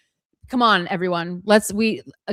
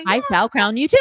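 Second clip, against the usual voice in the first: normal talking voice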